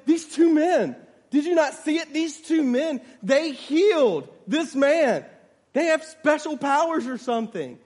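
high, loud, emphatic excitement; amazed report. Acted. Old lady telling a man of the healing, amazed report.

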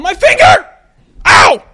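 loud hammed-up yelp of pain; mock pain yell. Hitting a finger with a hammer, loud mock yell. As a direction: shout like you just hit your finger. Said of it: "you're going".

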